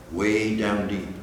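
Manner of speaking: plain reading voice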